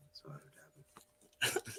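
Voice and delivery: whispered, slow question; whispered question to the friend who broke his phone; soft asking tone. Retold or imitated. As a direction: ask it gently, almost breathed. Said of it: "that was my tone of voice".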